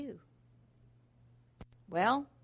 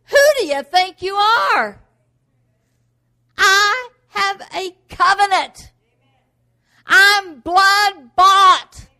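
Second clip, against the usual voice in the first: loud slow shout, higher, hammering each phrase; slow, loud, higher defiance; defiant shout; 7 semitones higher, 16 dB louder, much slower than usual. Pretend. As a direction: rebuke the devil with full force. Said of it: "when the devil comes to attack you".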